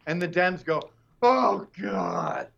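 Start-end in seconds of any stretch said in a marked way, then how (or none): 1.2-2.5 exasperated, dismissive groan, louder; imitated groan of loud contempt; mock groaning. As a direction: groan it out like you're fed up. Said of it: "Did you hear them groan?".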